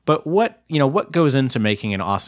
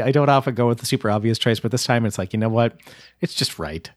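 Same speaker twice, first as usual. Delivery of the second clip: normal talking voice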